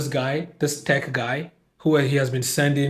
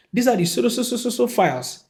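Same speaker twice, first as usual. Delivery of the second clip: higher, stammering so, so, so before files; higher mock generic line; 8 semitones higher than usual. Mocking the secretary's generic reply, pitched higher. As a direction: squeak out the vague line, tripping on so. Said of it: "Let's hear the specific documents".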